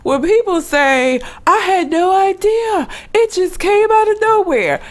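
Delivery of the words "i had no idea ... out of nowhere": high-pitched feigned cluelessness; mock innocence. Quoting people who claim they never knew, mock innocence.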